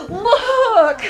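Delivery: high, loud, thrilled exclamation; delighted amazement. Real, not acted. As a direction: burst out with real delight after a gasp. Gasps, delighted at student's drawing.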